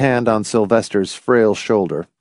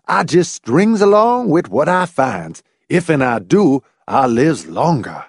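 exaggerated mock-servile Southern dialect; burlesque Southern dialect; 5 semitones higher than usual. A narrator's reading. Laws taunting Hamilton, burlesque Southern dialect. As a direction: lay on a Southern dialect, swooping and mocking. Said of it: "Laws burlesqued".

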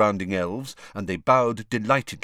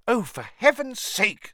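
angry; 14 semitones higher than usual. A narrator's reading. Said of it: "said Hermione angrily".

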